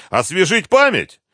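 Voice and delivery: high, loud menacing offer; threatening. A narrator's reading. Colonel offers to jog captive's memory, threatening. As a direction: make the question a threat. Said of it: "с угрозой в голосе".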